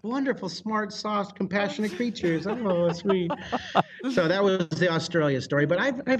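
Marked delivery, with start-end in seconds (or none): 0.0-3.3 higher-pitched mock-sweet praise; sarcastic gushing. Guest mocking the dolphins who beat him up, sarcastic gushing. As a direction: coo over them with obvious irony.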